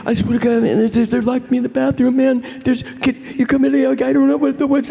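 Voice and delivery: high panicked phone plea; frantic friend. Retold or imitated. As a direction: rattle it off scared.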